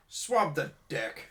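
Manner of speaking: slow haughty order; bossy captain. Retold or imitated. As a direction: talk down to a deckhand. Mimicking snobby captain.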